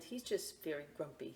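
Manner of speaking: plain reading voice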